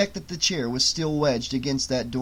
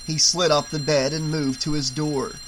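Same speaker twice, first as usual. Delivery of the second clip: ordinary delivery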